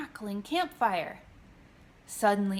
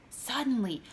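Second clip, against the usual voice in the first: plain reading voice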